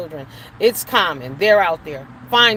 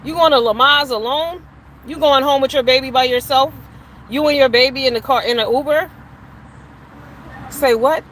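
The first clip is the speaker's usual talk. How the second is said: louder, rising mock disbelief; incredulous; 4 semitones higher, 5 dB louder than usual. Real, not acted. To women who go it alone, loud incredulous questions. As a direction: pile on the disbelief.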